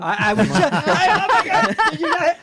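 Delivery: high-pitched giggly laughter; laughing. Real, not acted. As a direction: laugh through the words, high. Cracking up at the sexy voice.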